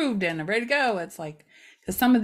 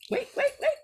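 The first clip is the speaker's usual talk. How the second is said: high-pitched wait; faster than usual. Real, not acted. Searching bag excitedly.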